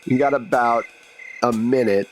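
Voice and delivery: ordinary talk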